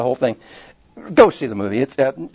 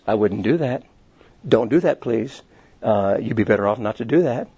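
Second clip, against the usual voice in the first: low, soft, unruffled warning; very calm; 5 semitones lower, 3 dB quieter than usual. Acted. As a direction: caution them quietly and calmly, without raising your voice. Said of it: "in a very calm voice".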